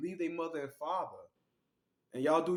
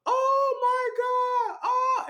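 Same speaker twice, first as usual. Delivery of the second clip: high, loud, drawn-out scream; screaming in shock; 8 dB louder, much slower than usual. Retold or imitated. Mother and daughter screaming after the gunshot, slow high shout. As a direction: wail it slow in horror.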